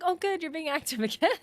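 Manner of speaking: high, chirpy mock smartwatch voice cheering her on; sarcastic watch voice. Her watch praising her flailing arms, in a high mock-chirpy voice. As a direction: play the watch as a smug little cheerleader. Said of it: "It's like".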